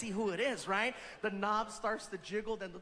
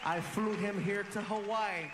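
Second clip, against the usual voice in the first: louder announcer build to name; announcer hype; 3 semitones lower, 3 dB louder than usual. Acted. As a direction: bring him out to the crowd. Announcer introducing guest.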